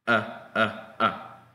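relaxed central "Ah", like uh; loose-mouthed schwa "Ah"; schwa sound. Shown as a demonstration. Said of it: "eso se pronuncia así".

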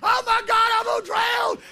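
hammed-up high, loud wailing; crying, mad boy. Boy just pulled from river, acted tearful angry wail. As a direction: wail in outraged panic, imitating a child. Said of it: "I'm crying. I'm mad".

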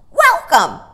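high, loud parrot shriek. A narrator's reading. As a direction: shriek a welcome. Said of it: "shrieked a large hyacinth macaw".

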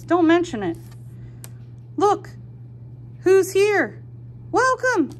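high, loud, bouncy little pig; cheerful pig voice. Acted. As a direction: greet the visitors with glee. Pig greets friends in story.

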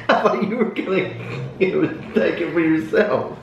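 laughing high through the words; teasing between laughs. Real, not acted.

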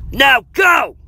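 high, loud order; commanding yell. A narrator's reading. Jeff orders his friends to flee, yelling. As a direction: shout to send them running. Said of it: "he yelled".

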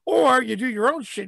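ordinary talk

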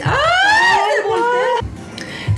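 high, slow shriek with laughter; high laughing shriek. Real, not acted. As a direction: squeal and laugh at it. Toy flips again.

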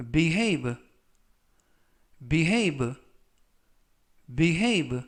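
careful "behave" with a falling end; slow model reading. Shown as a demonstration. Teacher modelling a word for students, slowly. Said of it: "천천히 세 번씩 읽어드리고요".

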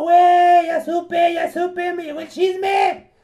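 gloating shout. Acted. Joker gloats about the gossip.

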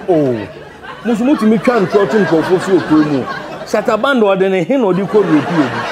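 ordinary delivery

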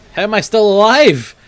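loud, high surprised cry; stunned outburst at surviving; shocked disbelief. Real, not acted. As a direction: yell it in disbelief. Survives a fight against odds.

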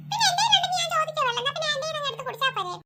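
put-on high child voice answering back; a kid snapping at the adults; child retorting about water; cheeky child.

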